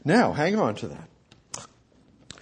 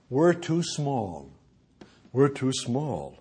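small, quiet voice; 4 semitones lower, 7 dB quieter, slower than usual. Retold or imitated. Andrew feeling too small.

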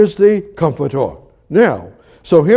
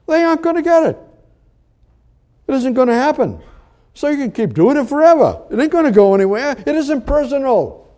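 preaching high; 9 semitones higher, faster than usual. Real, not acted. Preacher pressing the congregation, voice pitched high. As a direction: pitch leaps way up on the point.

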